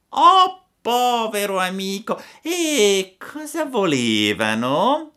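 very high, wailing mock sympathy; the cat overacts its sorrow; fake pity. (il gatto finge compassione, voce acutissima)

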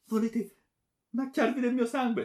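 very high, loud wail; terrified plea. A narrator's reading. Daughter asking if he wants her blood, terrified. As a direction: cry it out, appalled and begging.

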